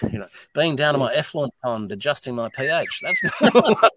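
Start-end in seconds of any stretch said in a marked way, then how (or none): 3.0-4.0 breaks into a high laugh mid-phrase. Real, not acted. Laughing at his effluent pond chores while telling the host. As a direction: laugh through the words.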